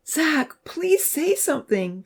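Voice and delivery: high, slow tearful-style plea; high-pitched begging; pleading. A narrator's reading. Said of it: "A voice was pleading with him".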